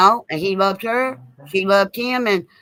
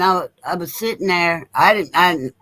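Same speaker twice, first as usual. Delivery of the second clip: normal talking voice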